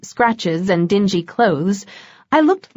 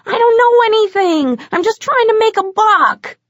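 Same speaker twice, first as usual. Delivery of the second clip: much higher, louder pleading whine; whiny vampire; 14 semitones higher, 4 dB louder than usual. A narrator's reading. Captured vampire pleading with Bones, high whine. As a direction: beg your way out of trouble. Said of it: "Tony tried again".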